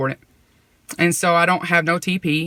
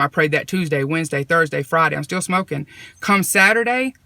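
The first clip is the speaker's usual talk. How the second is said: ordinary talk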